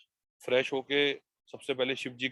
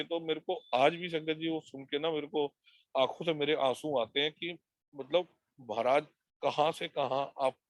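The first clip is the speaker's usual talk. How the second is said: ordinary talk, nothing marked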